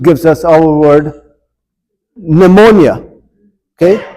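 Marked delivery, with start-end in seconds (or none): 2.2-3.0 "pneumonia" starting on the N sound; silent P. Shown as a demonstration. Teacher contrasting English with Greek, "pneumonia" with a silent P. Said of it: "of course, it's silent".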